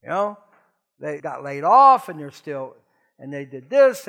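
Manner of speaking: ordinary talk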